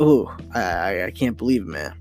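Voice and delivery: usual speaking voice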